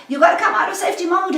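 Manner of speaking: ordinary talk, nothing marked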